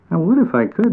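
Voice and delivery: a small creature sorry he can't; little bat. A narrator's reading. Bat voiced in the story.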